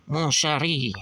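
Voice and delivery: lightly put-on French accent. Comic reader trying out Lady Liberty's lines, French accent. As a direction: say it with a touch of French. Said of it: "she's gotta have a French accent".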